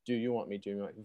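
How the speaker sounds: softly quoted, straightforward question; very straight. Retold or imitated. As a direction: ask it quietly, straight, with humility. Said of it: "Very straight".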